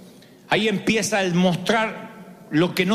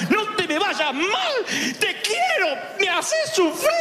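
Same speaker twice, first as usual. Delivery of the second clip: high, slow frantic mom scolding; frantic mother; 10 semitones higher than usual. Retold or imitated. Mother finds her lost son, frantic scolding. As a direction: yell like a panicked mother. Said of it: "la de mi mamá".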